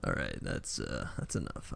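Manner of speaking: low, soft gruff voice held on; bad action-hero voice; staying in the Liam Neeson voice. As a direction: keep the deep movie growl going. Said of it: "I can stop doing that".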